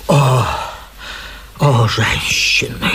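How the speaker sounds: low, slow whisper from under the bed; whispered sigh. Acted. Hidden man repeats from under bed.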